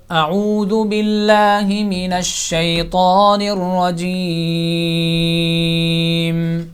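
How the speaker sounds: slow, melodic tajweed chant; solemn, slow Quranic recitation. Acted. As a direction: recite slowly, drawing out each vowel.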